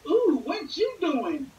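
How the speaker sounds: high, drawn-out mocking coo. Peeking stranger mocked, high slow coo. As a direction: coo it slowly to mock the peeper. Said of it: "They be like".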